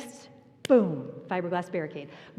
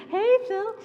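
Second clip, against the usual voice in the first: laughing hello; 12 semitones higher, 5 dB louder than usual. Retold or imitated. Waving to Phil while stranded.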